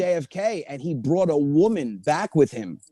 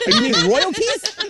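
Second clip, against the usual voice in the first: laughing correction; 12 semitones higher, 4 dB louder than usual. Retold or imitated. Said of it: "I said".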